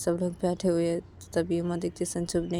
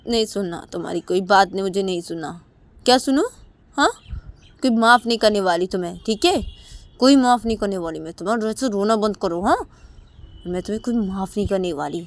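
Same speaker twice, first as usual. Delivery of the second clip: louder, firm cold refusal; angry refusal; 7 dB louder than usual. Acted. Uma angrily refuses to forgive Sanju. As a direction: refuse him flatly, still angry. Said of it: "Uma Sanju को बोलती है".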